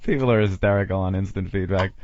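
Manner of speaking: ordinary delivery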